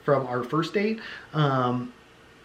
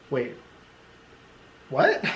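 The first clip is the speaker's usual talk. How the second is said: stunned wait what. Retold or imitated. Shocked by his crude remark.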